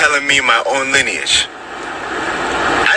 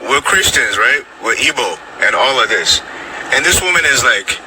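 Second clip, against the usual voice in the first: normal talking voice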